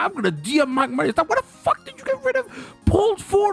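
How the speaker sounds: high, squawking retold outrage; mad ranting. Streamer replaying his planned message to developer, mad. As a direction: replay losing it, voice shooting up. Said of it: "I was so mad, bro".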